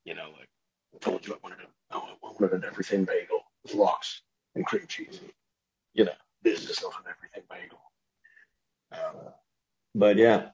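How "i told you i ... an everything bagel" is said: hushed, whispery action star impression; Liam Neeson. Actor fussing over a bagel order, hushed menace parody. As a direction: complain very softly, as the actor would. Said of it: "have him talking about not stuff like that".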